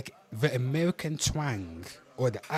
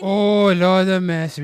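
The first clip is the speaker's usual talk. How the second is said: loud, high cry for mercy; exasperated outcry; 6 semitones higher, 12 dB louder than usual. Real, not acted. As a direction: wail it out loudly, begging for relief. Cuts off the clip in dismay.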